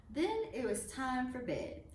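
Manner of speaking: ordinary delivery, nothing marked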